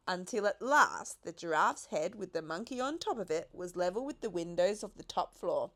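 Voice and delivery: plain reading voice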